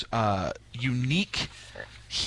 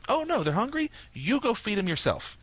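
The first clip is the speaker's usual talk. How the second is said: Jesus retorting; 6 semitones higher than usual. Retold or imitated. Priest voices Jesus answering disciples.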